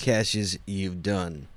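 very slow, low announcer delivery; deliberate show-opener voice; announcer intro. Pretend. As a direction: space out each word for the big reveal. Announcer-style intro to the segment.

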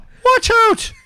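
put-on very high kid's yelp; boy calling out. Brendan warning others on the path, high called-out voice. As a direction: squeal a warning back over your shoulder. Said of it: "he called over his shoulder".

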